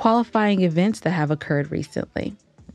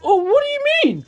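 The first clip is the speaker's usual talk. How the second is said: much higher, fast mock innocence; mock incredulous; much faster than usual. Wife mocks husband's feigned innocence, much higher and fast. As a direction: play his fake innocence big. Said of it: "acting incredulous".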